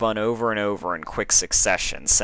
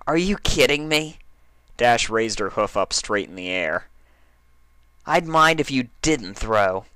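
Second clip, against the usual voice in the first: eager; 4 semitones higher than usual. Acted. Dash eager reply.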